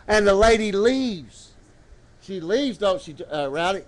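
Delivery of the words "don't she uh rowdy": ordinary talk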